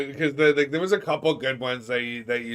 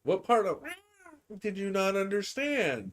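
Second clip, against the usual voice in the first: high, laughing mock scold; 7 semitones higher than usual. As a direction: play the cat scolding. Voicing the cat's scolding.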